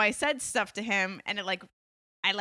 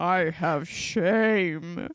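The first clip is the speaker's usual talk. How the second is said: slow, low, drawn-out; deeper voice; 4 semitones lower, much slower than usual. Real, not acted. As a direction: drop into a deep, slow voice. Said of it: "you start to go into your deeper voice".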